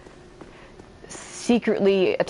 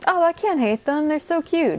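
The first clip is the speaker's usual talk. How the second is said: much higher, gushing mock-cute voice; cutesy self-quote; 10 semitones higher than usual. Player quoting her earlier self, gushing cutesy voice. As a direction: coo over the enemies as if adorable. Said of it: "like I said, five minutes ago".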